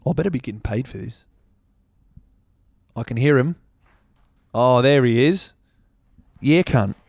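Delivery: put-on Aussie accent; hammed-up Australian accent; mock Australian accent. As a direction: keep up a playful, punchy Australian voice. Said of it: "I was talking in different accents".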